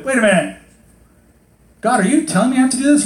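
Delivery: high, loud, quick challenge; acted incredulity. Believer questioning God about obligations, incredulous. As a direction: demand an answer in disbelief.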